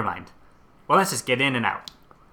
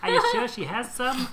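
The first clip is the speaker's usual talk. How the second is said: put-on higher old lady voice; 12 semitones higher than usual. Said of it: "Yeah, that's better".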